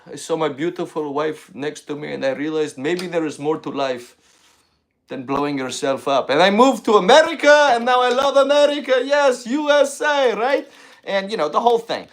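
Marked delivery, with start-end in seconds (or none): 0.1-10.7 put-on proud reformed terrorist in a Middle Eastern accent. Comic in character praising America, in a thick Middle Eastern accent. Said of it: "that accent".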